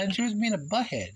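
ordinary talk

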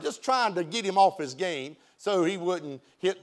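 ordinary delivery

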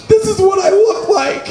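very high-pitched comic wail; hammed-up tearful squeak; mock crying. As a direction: squeal it out as if bawling at the mirror. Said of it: "when I was crying and I was like".

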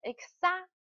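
"exa-" cut off right after a stressed "xa"; stress on second-to-last syllable. Shown as a demonstration. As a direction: lean on the "xa" in "exa-". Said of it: "它的重读的音节在于倒数第二个音节".

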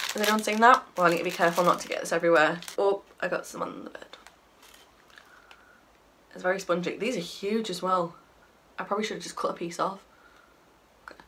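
usual speaking voice

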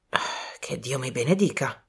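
hushed, low amazement; astonished. Acted. Colonel examines the piece of glass, quietly astonished. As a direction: breathe out a low, stunned oath. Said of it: "esclamò sbalordito".

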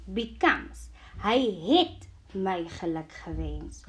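ordinary talk

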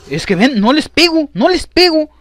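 high, loud frustrated protest; exasperated shouting. Real, not acted. Gamer whose shots keep missing, exasperated shouting. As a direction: climb quickly into an outraged yell.

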